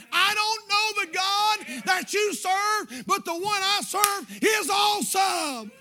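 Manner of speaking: slow, high, loud preaching cry; excited shout. Real, not acted. Pastor overcome with excitement before his church, slow shouted cry. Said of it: "I can't help but just get excited".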